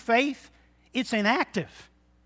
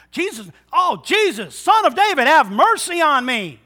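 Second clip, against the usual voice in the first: higher and loud, pleading for mercy; desperate cry; 7 semitones higher, 8 dB louder than usual. Acted. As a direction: cry out with everything you have. Blind man crying out to Jesus.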